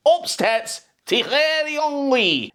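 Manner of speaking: drawn-out sarcastic intro; sarcastic fanfare. Real, not acted. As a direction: announce him with scorn. Sarcastic announcement.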